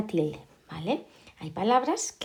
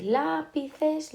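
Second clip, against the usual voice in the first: LÁpices, first syllable high and stretched; stress on first syllable; 6 semitones higher than usual. Shown as a demonstration. Teacher testing where the stress falls, LÁpices stressed on LÁ. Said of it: "¿dónde está la sílaba fuerte?".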